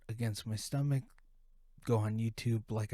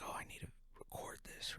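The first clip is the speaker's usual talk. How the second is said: whispering to himself; 16 dB quieter, faster than usual. Retold or imitated. Himself deciding to secretly record.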